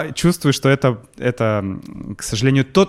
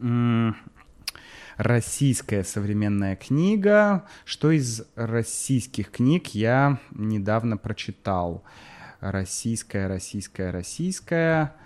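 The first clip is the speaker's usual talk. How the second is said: much softer, slow muttering to himself; thinking aloud; 9 dB quieter, slower than usual. Real, not acted. Host mutters softly while recalling a Russian book. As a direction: trail off, searching memory.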